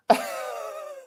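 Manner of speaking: high, squealing laugh; real laugh. Real, not acted. Cracking up over that look, high squeal of laughter.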